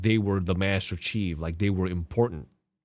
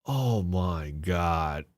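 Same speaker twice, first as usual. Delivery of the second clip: weary disbelief; 3 semitones lower, much slower than usual. Real, not acted.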